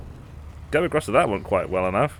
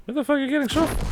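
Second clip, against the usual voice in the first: high squeaky mock question; faster than usual. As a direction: pitch it way up, squeaky and fast. Repeats it in squeaky voice.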